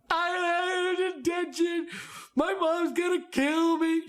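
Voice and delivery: x0.6 whiny mock sob story; mock tearful student. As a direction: fake tears to dodge a detention, slow and whiny. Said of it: "one of the baits that often that works is tears".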